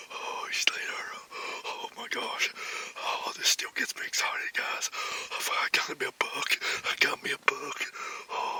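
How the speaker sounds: breathless excited whisper in a hunting blind; hushed, giddy whisper after a kill. Real, not acted. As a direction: whisper so the deer won't hear, thrilled.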